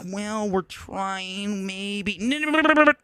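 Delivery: high, drawn-out mock dithering; slow, spineless hemming and hawing, sent up; wishy-washy mocking. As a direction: mimic a feeble waffler, trailing into high babble. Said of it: "we just have this world that's like".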